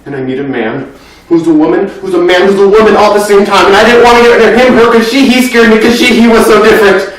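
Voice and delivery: starting level, climbing to loud, high panic; flustered, escalating babble; mounting fluster. Acted. Confused, scared by person met, acted.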